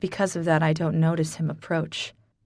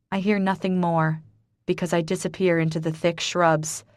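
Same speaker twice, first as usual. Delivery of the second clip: ordinary delivery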